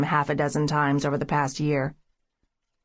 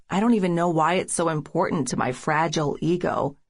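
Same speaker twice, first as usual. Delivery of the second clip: ordinary delivery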